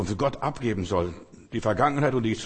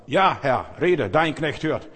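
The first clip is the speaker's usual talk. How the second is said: loud, slow and earnest reply; the boy Samuel answers the voice, loud and earnest; fervent answer; 4 semitones higher, 5 dB louder, slower than usual. Acted. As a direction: answer God's call boldly. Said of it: "Und sagt".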